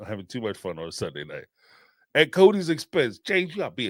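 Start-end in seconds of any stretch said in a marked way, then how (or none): 0.1-1.4 much softer, faster low aside; muttered under breath. Real, not acted. Host repeating the line to themself, muttered softly. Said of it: "repeated softer".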